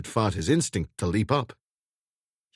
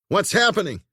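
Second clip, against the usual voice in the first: higher, louder urgent call; shouted question; 10 semitones higher, 4 dB louder than usual. A narrator's reading. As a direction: shout to her behind you. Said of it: "he shouted".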